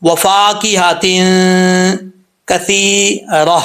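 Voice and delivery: slow drawn-out tajweed recitation; practice recitation. Shown as a demonstration. Teacher gives practice line, slow recitation. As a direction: recite slowly for them to copy. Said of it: "یہاں سے practice کریں".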